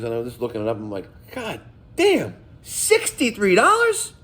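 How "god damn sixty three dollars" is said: higher, drawn-out disbelief; shocked at the price. Retold or imitated. Plumber reading his invoice, slow shocked disbelief. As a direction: stretch out "sixty-three dollars" in disbelief.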